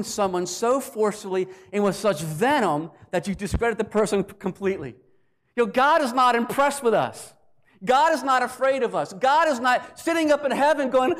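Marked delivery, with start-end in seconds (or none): 5.6-11.1 raised, emphatic preacher's voice; forceful preaching. Real, not acted. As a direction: drive it home to the congregation, louder.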